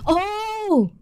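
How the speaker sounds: high startled oh; surprised cry. Real, not acted. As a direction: gasp out a high oh. Finds something missing.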